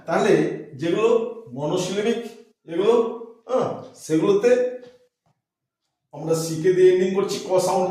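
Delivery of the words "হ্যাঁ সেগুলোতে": ordinary talk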